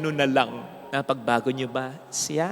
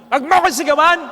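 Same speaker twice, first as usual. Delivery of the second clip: mock shout back; 10 semitones higher, 11 dB louder, faster than usual. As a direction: yell it back much higher and louder. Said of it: "ano ang unang banat ng sinigawan mo?". (pasigaw na ginagaya ang sinigawan)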